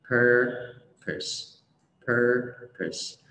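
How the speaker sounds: "purpose" split slowly into PUR, pus; correct pronunciation. Shown as a demonstration. Teacher drilling learners syllable by syllable, PUR-pus. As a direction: break "purpose" in two, first syllable strong. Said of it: "không phải purpose nha mọi người".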